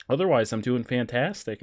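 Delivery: ordinary talk, nothing marked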